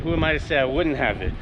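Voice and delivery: ordinary talk, nothing marked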